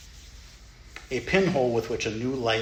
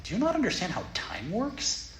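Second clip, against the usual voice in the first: mock-exasperated, higher and pushing harder; sarcastic disbelief at a Dharma talk; incredulous retort; 8 semitones higher, 4 dB louder than usual. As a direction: scoff at the idea like it's absurd. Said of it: "I'm like".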